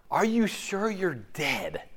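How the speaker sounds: ordinary talk, nothing marked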